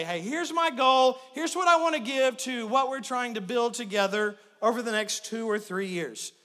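ordinary delivery

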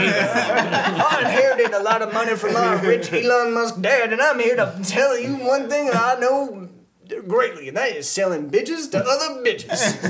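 slow, laughing Texas businessman pitch; Texas accent. Acted. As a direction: ham up a rich Texas businessman. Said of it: "In the Texas accent, I was doing a character".

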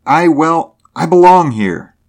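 high, loud, stumbling start; halting insistence. A narrator's reading. Driver falters then claims his birthplace, halting insistence. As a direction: blurt it out in broken pieces.

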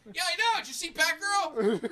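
high, loud goofy voice for his penis; a boy's penis greeting him cheerfully; voicing a penis. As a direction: play the penis as a chirpy buddy. Penis voice continues.

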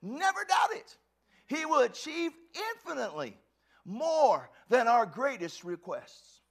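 loud, high and slow emphasis; preaching shout. Real, not acted. Preacher proclaiming scripture to the church, shouting. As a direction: drive the promise home.

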